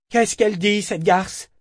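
high, loud angry demand; furious. A narrator's reading. Eta demands to know what was said, furious. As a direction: snap it out in a fury. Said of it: "demanda-t-elle en furie".

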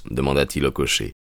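ordinary delivery

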